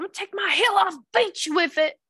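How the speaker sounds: high, quick mock threat; hammed-up high-pitched threat; imitating fighting friend. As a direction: play the friend spoiling for a bar fight. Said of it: "She said".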